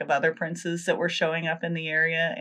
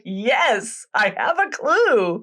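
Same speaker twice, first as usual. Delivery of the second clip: raised-pitch cry of victory, stretched at the end; mock triumph; 5 semitones higher, much slower than usual. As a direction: play the prince triumphantly finding a lead. Prince triumphant with the shoe.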